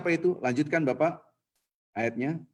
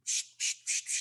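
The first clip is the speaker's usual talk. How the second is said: soft hissed psh like a tempter in the ear; devil's hissed whisper; 9 dB quieter than usual. Retold or imitated. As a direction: mimic a sly whispering noise.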